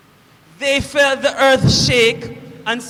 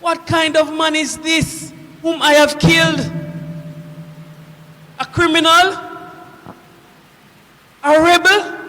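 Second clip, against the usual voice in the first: loud, rising, incredulous questions; anguished questioning; 3 semitones higher, 4 dB louder than usual. Acted. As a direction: demand an answer, building louder with each guess. Centurion demands who he killed.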